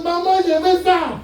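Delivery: put-on high, drawn-out kid's demand; demanding child. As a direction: nag mum like a child in the shop.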